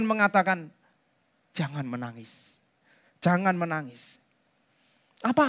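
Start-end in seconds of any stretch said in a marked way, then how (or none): 1.5-2.6 hushed, much softer and lower, as if consoling; tender comfort. Acted. Jesus comforts grieving widow. 3.2-4.2 soft, lower consoling repeat; gentle comfort. Acted. Jesus comforts grieving widow.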